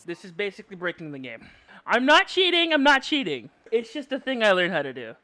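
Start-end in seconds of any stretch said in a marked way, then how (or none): 1.9-3.5 defensive protest. Real, not acted. Defending against chat.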